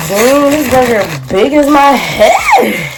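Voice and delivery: громко и выше, с восторгом, медленно; кричит, восторгаясь огромным бургером; excited shout. Real, not acted. Excited at the huge burger.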